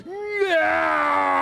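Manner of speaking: high shouted mock roar; Tiny's cute high roar; little roar. A narrator's reading. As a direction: roar as scary as a small dino can. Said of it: "my terrific roar".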